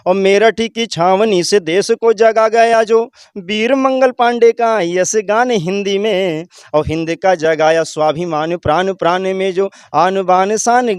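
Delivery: slow, rising patriotic declamation; poem recitation. Shown as a demonstration. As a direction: recite each line proudly, stretched out. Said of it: "एक भाव मैं आपको सुना रहा हूँ".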